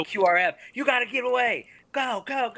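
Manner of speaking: high-pitched evacuation call; urgent mock yell. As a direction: imitate someone urgently sending people running. Retold urgent order to flee.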